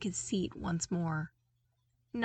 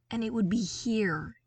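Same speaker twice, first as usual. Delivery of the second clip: ordinary delivery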